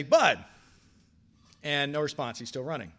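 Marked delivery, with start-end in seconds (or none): 0.0-0.4 calling out. Retold or imitated. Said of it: "and I say".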